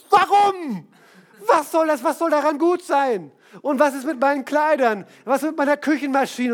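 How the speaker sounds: höher und lauter, fassungslos protestierend; empört. Retold or imitated. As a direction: aufgebracht nachfragen. Frau empört über Verkauf. (empörte Ehefrau)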